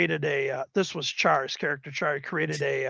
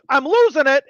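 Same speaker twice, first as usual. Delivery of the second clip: high, loud mock panic; retold mock scream; 10 semitones higher, 9 dB louder than usual. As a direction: imitate a wizard losing control. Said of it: "A wild mage guy screaming".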